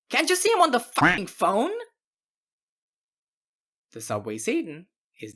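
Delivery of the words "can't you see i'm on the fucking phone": higher, louder furious retort; angry snap. Acted. Cashier on the phone snapping at a customer, furious. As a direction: bite his head off, outraged.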